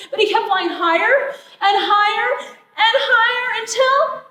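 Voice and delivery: high, loud narration climbing to a climax; storyteller racing toward disaster; building excitement. A narrator's reading.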